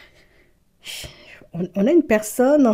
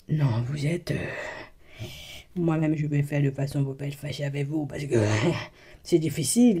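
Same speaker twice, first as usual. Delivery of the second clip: laughing aside; 7 semitones lower, 7 dB quieter than usual. Real, not acted. Laughing low aside to the viewers. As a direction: laugh it off, lower.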